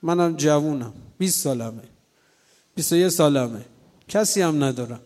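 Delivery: ordinary talk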